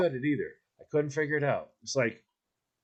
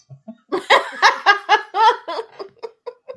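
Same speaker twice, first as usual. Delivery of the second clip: high-pitched cackling laugh; 6 dB louder than usual. Real, not acted. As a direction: laugh high and loud at your own joke. Laughing at own punchline.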